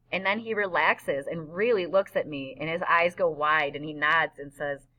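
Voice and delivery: usual speaking voice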